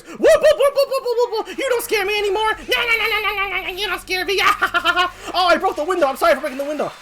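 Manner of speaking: high, loud giddy whooping; manic bravado. Acted. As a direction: taunt wildly on an adrenaline rush. Adrenaline makes character fearless.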